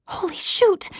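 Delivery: high, breathless whisper; startled whisper. Acted. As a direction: gasp it out in a whisper at the doorbell. Startled by the doorbell late night.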